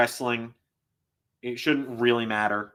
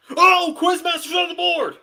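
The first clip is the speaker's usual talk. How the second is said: much higher, loud, ringing cry of glee; excited shout; 7 dB louder than usual. Real, not acted. Streamer celebrating his pick winning, gleeful shout. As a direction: crow about the win. Reacts to betting win.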